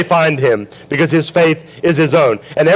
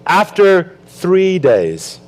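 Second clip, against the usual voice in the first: slow dramatic reading; much slower than usual. Shown as a demonstration. Preacher reading scripture to the congregation, very slow and weighty. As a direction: stretch every word out, very slow. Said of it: "Watch how the Bible explains this".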